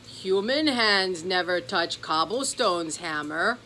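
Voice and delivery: slow, odd gruff little leprechaun; a grumpy old leprechaun asking. Acted. Cobblestone the leprechaun questioning the gift, slow odd voice.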